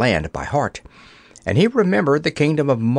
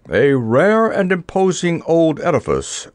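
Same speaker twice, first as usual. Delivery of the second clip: higher, projected knight's pronouncement; grand knightly remark; 5 semitones higher than usual. A narrator's reading. Sir Hokus, looking up at the castle, pronounces grandly. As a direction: observe it grandly, like an old knight.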